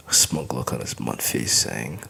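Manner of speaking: ordinary delivery, nothing marked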